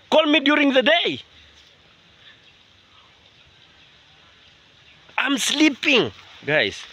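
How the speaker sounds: high, indignant rant at a late caller; exasperated scolding. Real, not acted. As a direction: complain at a high pitch about being woken.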